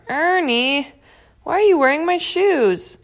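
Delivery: high-pitched, indignant question. A narrator's reading. Bert sees Ernie in his shoes, indignant. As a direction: pitch it way up in mild outrage.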